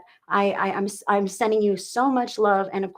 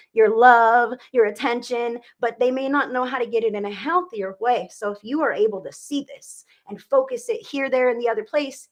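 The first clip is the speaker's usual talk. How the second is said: ordinary talk, nothing marked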